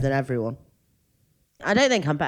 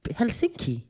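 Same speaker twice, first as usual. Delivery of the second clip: "Helsinki" said high with a Welsh lilt; Welsh-sounding Helsinki; 7 semitones higher, 4 dB quieter than usual. Shown as a demonstration. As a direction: sing "Helsinki" like a Welsh place name. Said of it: "Sounds Welsh, doesn't it?".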